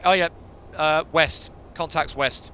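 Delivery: loud urgent callout; shouting. Real, not acted. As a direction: bark the contact out loud. Calling out contacts in combat.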